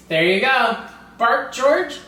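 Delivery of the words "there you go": ordinary delivery, nothing marked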